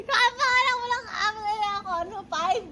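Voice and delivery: laughing, high and fast. Real, not acted. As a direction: tease through laughter. Daughter teases back laughing.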